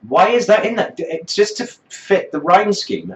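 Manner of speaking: high exasperated complaint. Real, not acted. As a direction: voice climbing in disbelief. Exasperated rant about lyric.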